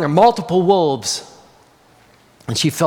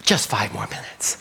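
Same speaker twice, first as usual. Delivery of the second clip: much lower, soft mumble; quiet mumble; 10 semitones lower, 7 dB quieter than usual. Acted. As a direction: mumble it, barely heard. Lamb ignores call.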